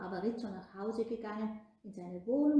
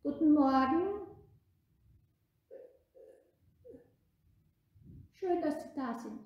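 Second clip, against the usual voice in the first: friendly greeting; 6 semitones higher, 7 dB louder than usual. Acted. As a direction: greet the bishop sweetly this time. (freundlich begrüßt)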